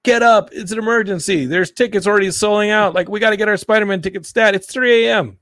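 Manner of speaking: high, frantic wake-up call; mock panic call. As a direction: rouse a sleeping friend in mock panic. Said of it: "calling Ian and being like".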